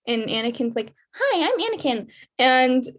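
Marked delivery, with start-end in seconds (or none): 1.1-2.1 put-on high-pitched little-kid voice; Anakin Skywalker impression. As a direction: put on a high, childlike greeting. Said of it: "a perfect Anakin Skywalker impression".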